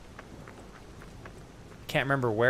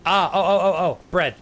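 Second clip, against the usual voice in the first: excited find; 6 semitones higher, 8 dB louder than usual. Real, not acted. Finds bread in game.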